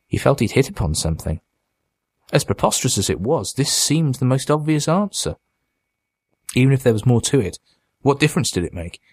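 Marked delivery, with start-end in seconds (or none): none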